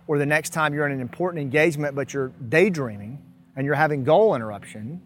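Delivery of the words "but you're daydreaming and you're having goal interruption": ordinary delivery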